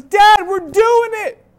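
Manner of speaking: put-on high, loud, drawn-out kid's shout; imitated excited kid. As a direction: mimic a son yelling gleefully to dad. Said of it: "Zion's having so much fun".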